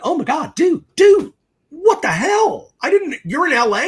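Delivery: high, loud, stretched-out disbelief with a crack of a cry; nearly shrieking at an old friend; shocked reunion. Retold or imitated. Running into old buddy Eric at a beach party, high shocked yell. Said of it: "And I'm like".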